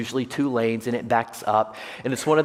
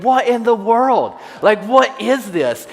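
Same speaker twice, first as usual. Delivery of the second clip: higher, louder, slow incredulous thought; baffled disbelief; 10 semitones higher, 8 dB louder, slower than usual. Retold or imitated. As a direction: draw it out in disbelief. His own baffled thought.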